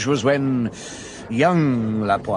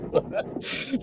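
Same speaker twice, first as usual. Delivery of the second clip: laugh; 8 dB quieter than usual. Real, not acted. Laughs at the story.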